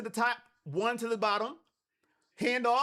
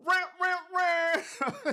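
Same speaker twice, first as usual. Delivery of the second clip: 1 mock crowd roar; 5 semitones higher, much slower than usual. Shown as a demonstration. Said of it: "I'll do the sound effects".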